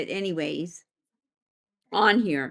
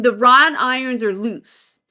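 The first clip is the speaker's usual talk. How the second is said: usual speaking voice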